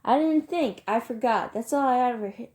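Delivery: loud, high angry mimicry of excuses; furious, mocking yell; shouting. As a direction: throw the excuses back at him in a shout. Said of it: "Derry shouted".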